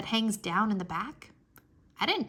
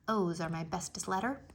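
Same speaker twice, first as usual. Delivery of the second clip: plain reading voice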